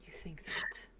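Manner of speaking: doubtful whispered question. Acted. Father whispering doubt to his daughter. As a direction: breathe the doubt back.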